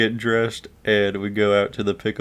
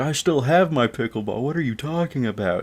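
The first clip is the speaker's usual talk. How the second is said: indignant protest; 6 semitones higher than usual. Retold or imitated. Blamed kid protests, acted.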